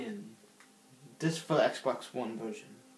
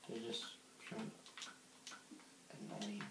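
whispering; 7 semitones higher, 18 dB quieter, much slower than usual. Real, not acted. Muttered complaint about silverfish.

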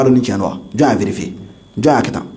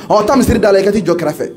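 higher-pitched acted brush-off; dismissive order; 7 semitones higher than usual. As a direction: send them off to stand aside, mildly impatient. Acted dismissal of someone at mosque. (ka mɔgɔ gɛn)

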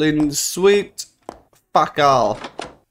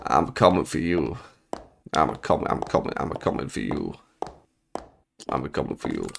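creepy taunt; 9 semitones lower, 8 dB quieter than usual. Pretend.

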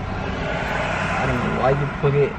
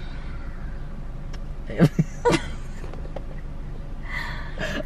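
silent wheezing laugh; 7 dB quieter than usual. Real, not acted. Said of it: "why did you stutter".

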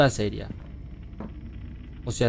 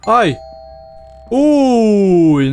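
startled scream; 9 semitones higher, 7 dB louder than usual. Real, not acted. Jump scare.

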